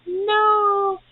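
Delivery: high drawn-out no; mock dismay. Kai hearing his sister was taken, high drawn-out no. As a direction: stretch the no up high in mock despair.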